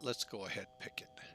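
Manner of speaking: hushed, conspiratorial aside; playful whisper. Real, not acted. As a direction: drop to a whisper for the decision. Sudden playful decision to the viewers.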